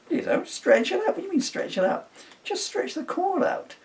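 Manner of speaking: a high silly voice mocking the argument; squeaky mock voice. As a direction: pitch it way up as a cartoon of himself.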